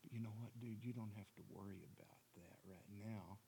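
the devil whispering temptation in his ear; seductive whisper. Acted. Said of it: "I'm gonna whisper in his ear".

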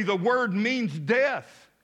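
ordinary talk, nothing marked

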